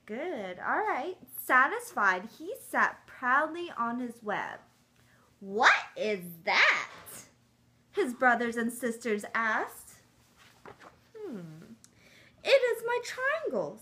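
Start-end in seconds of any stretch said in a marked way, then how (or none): 1.4-11.7 lively storybook read-aloud; reading to children. Acted. 12.4-13.8 high, proud little voice; small spider voice. Acted. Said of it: "Seaver said".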